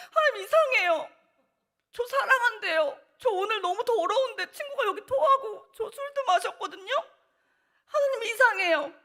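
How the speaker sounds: high and loud, laughing through sobs; laugh-crying. Retold or imitated. Girl sobbing in the church hallway, laugh-crying.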